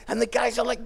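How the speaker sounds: usual speaking voice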